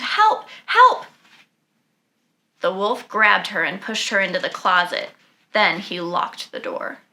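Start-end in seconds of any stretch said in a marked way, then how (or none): 0.0-1.1 high, loud storybook scream; screaming for help. Acted. Grandmother sees the wolf and screams, high and loud. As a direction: cry out for help at the top of the voice. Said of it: "she screamed".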